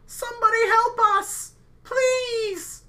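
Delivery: high, loud cry for help in a put-on voice; shrill-pitched townsperson screaming for rescue. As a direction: scream out a desperate plea, pitched far up. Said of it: "the screams of somebody saying".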